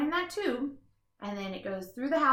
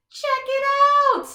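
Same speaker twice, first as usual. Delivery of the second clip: excited show-off; 13 semitones higher, 10 dB louder than usual. Acted. Showing off gadgets.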